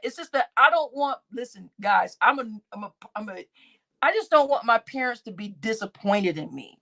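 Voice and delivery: usual speaking voice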